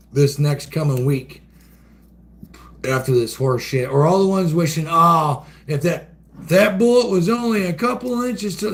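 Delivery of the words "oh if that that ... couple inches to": higher, mocking whine of the commenters; mock wishful commenter. Mocking commenters who wish the bullet hit, higher. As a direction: ham up the online critics. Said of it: "basically wishing death upon it".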